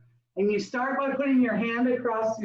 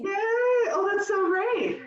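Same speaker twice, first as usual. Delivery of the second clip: real high-pitched delight; delighted cheer; 10 semitones higher, slower than usual. Real, not acted. Leader hearing someone has never done this, delighted cheering. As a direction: squeal a yay and gush on. Cheering a first-time participant.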